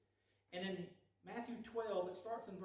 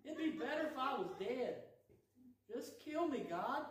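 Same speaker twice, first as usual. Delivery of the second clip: much higher, louder, whining plea; hammed-up sulking prophet; whiny Jonah; 7 semitones higher, 4 dB louder than usual. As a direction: whine it like a three-year-old. Jonah pouting, fainting in the heat.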